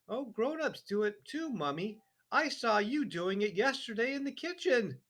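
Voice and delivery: put-on high, innocent child voice; little girl. A narrator's reading. Matilda innocently teasing her father, high sweet voice. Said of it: "Matilda said".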